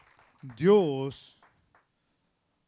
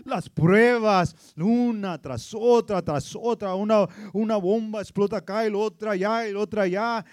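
loud, higher, shouted sermon climax; fervent preaching; 6 semitones higher, 15 dB louder than usual. Real, not acted. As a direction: preach it at full force. Preaching climax at full force. (predicación a todo pulmón)

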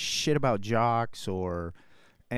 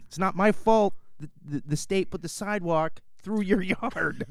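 shouting at neighbor; 8 semitones higher than usual. Retold or imitated. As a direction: yell back half laughing. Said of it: "I'm like".

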